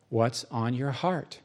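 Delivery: low, soft, slow question; gentle God. Acted. God asks the preacher about his heart, gently.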